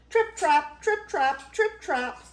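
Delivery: high, light rhythmic chant; tiny hoofbeats. A narrator's reading. Baby Gruff scurrying over the bridge, high patter. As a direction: tap the words out high and crisp.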